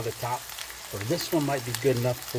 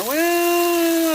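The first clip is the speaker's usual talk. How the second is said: long, loud, high-pitched "well"; drawn-out well; 9 dB louder than usual. Real, not acted. Doubting the burger will fit, drawn-out high well.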